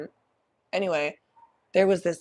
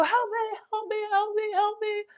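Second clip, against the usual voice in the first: very high, thin panicked begging; panicked pleas. Retold or imitated. Covered in caterpillars, begging her friend in a very high panicked voice. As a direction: plead for help like a scared child. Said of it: "sitting there crying".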